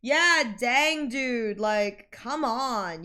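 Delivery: ordinary talk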